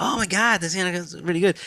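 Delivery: high, excited retold praise; impressed Americans. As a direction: imitate amazed Americans, high-pitched. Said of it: "Americans would be like".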